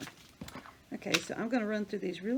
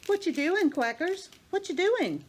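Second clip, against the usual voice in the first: much higher, louder sing-song pet voice; high cooing question to a duck; 10 semitones higher, 6 dB louder than usual. Real, not acted. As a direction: coo at the duck playfully.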